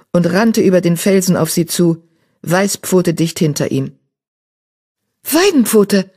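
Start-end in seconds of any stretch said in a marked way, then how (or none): 5.2-6.1 lifted call across the rock. A narrator's reading. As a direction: call his name from a distance. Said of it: "rief Eichhornpfote". (laut rufend)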